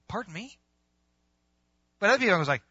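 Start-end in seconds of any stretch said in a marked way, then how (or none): 0.1-0.6 high, soft incredulous question; taken-aback query. Acted. Master hearing the talents were buried, taken aback. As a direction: lift the pitch in quiet disbelief.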